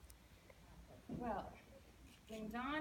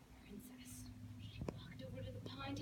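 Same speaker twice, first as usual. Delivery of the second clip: whispered storytelling; hushed narration; 5 dB quieter than usual. A narrator's reading. Narrator hushing the listeners as the princess nears the pond, whispered. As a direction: drop to a whisper to draw listeners in.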